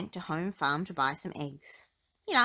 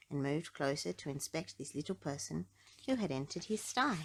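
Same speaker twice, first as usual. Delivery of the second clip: ordinary delivery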